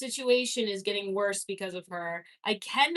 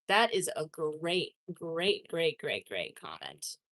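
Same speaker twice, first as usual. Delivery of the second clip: each great softer and lower until it dies away; fading repetition; 7 semitones lower, 6 dB quieter, slower than usual. Pretend. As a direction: let the repeated great sink into a murmur. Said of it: "That is a great comment".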